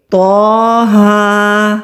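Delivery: تو ہا drawn out very slowly, تو said heavy; recited letters. Shown as a demonstration. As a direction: recite it long and heavy for students. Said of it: "ایک الف کے برابر کھینچ کر پڑھیں گے". (تو ہا کو لمبا اور موٹا پڑھ کر دکھانا)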